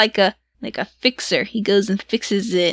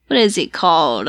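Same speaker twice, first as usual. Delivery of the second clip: ordinary talk, nothing marked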